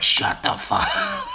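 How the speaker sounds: very high squawky parrot, cracking up; parrot echo. Retold or imitated. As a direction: squawk it right back while laughing. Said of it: "And he'd be like".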